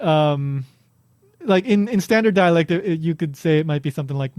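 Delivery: ordinary talk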